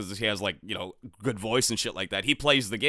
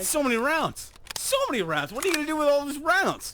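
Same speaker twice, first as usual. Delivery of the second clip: mock amazement; 14 semitones higher than usual. Mock awe at clip.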